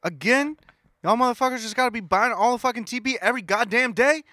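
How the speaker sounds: high, exasperated ranting; imitating a fed-up man losing his patience; mock angry rant. Imitating an angry shopper at Walgreens, high and exasperated. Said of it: "he was pissed".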